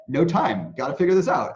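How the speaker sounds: half-acted anxious rush; mock panic. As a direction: blurt it like a panicked pianist. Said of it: "I would just be like".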